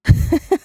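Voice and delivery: high, loud laugh; real laugh of surprise; amused burst of laughter. Real, not acted. Host reacts to the snail story.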